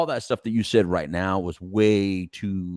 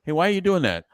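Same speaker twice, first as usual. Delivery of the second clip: higher-pitched, mild challenge; lightly acted questioning, pitched up; so-called aggression; 10 semitones higher, 3 dB louder, faster than usual. Shown as a demonstration. Dad questioning his kids, in so-called aggression. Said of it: "Their level of aggression is".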